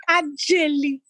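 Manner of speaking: high greeting breaking into a laugh; mimicking a friend's high hello with a laugh. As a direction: chirp it up high and laugh through the name. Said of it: "She said".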